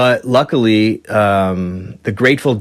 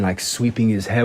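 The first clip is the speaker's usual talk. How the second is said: ordinary talk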